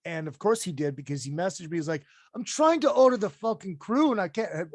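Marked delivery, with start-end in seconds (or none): 2.3-4.7 imitating an Australian fan who can't place an order, in a mock Aussie accent. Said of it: "my Australian sounds like fucking [name]".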